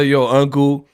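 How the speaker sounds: normal talking voice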